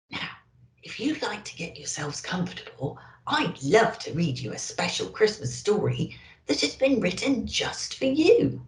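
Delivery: ordinary delivery, nothing marked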